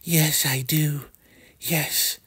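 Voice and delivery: high, slow dainty woman's voice; mock sweet woman. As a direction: coo his name like a demure lady. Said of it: "And she's like".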